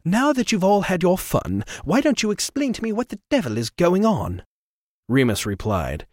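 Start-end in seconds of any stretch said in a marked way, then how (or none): 0.0-4.4 higher, smug theatrical voice; smooth, superior intervention; fussy gentleman. Acted. Trevor voiced.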